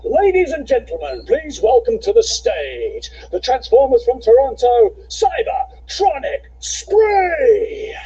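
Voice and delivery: high booming ring announcer; fighting game announcer. Acted. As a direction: hype up the band intro big. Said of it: "best announcer voice".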